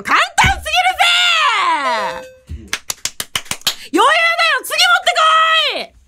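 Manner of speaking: triumphant shout. Real, not acted. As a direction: crow in victory. Boasting after succeeding. (勝ち誇って高く叫ぶ)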